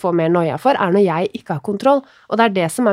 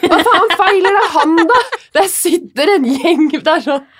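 high, loud, laughing disbelief; shocked outrage; 8 semitones higher, 4 dB louder than usual. Retold or imitated. To dad after the trip, loud laughing outrage. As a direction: cry out in appalled disbelief. Shocked reply to dad's news.

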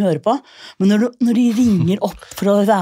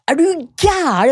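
shocked refusal; 9 semitones higher, slower than usual. Retold or imitated. Trondheim guy refuses TV.

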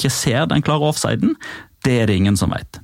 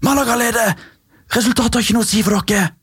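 higher mock shout from the bench, faster at the end; 10 semitones higher than usual. Bench player shouting to opposing defender: higher mock shout. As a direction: yell it up high like a bench player. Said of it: "sto på benken og ropte".

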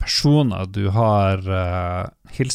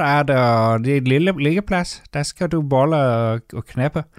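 mock Danish; 3 semitones higher than usual. Platform Dane welcoming Mats to Gorm, mock Danish accent. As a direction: play a Danish host in sing-song mock Danish.

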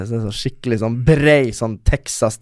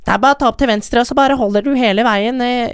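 much higher, light mock of a friend's voice; imitating Britt; 9 semitones higher than usual. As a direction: imitate Britt cheerfully giving directions. Friend Britt giving directions. (etterligner Britt med mye lysere stemme)

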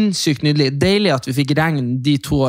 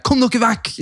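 shooing yell; 9 semitones higher, slower than usual. Retold or imitated. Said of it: "jeg bare sånn her".

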